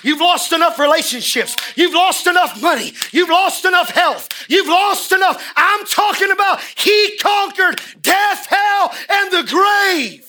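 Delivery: climbing from firm to full shouted preaching; building sermon shout. Real, not acted. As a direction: build it up till you're roaring at the congregation. Preaching crescendo.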